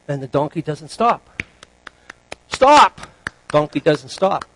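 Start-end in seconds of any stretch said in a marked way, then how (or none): 2.5-3.0 high, sharp acted yell; loud, urgent one-word command; alarmed shout. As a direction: shout to halt a runaway animal. Rider shouts at runaway donkey.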